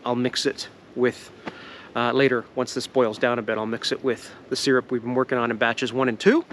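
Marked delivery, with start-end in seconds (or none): none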